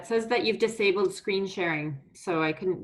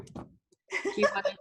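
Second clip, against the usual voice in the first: laughing; 11 semitones higher, 3 dB quieter than usual. Real, not acted. Laughing at repeated tech trouble.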